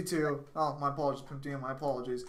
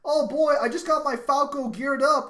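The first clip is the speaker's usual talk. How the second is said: mock excited fan; 8 semitones higher, 5 dB louder than usual. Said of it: "some guy's like".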